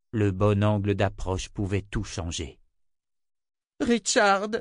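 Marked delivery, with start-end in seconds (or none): 3.8-4.5 high-pitched panicked call; frantic cry. A narrator's reading. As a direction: call for him at the end of your nerves. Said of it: "cria Jensen à bout de résistance nerveuse".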